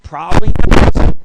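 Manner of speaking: loud comic punchline "Probably not"; loud emphatic repeat. Acted. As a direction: slam the "Probably not" home the second time. Same answer repeated with force.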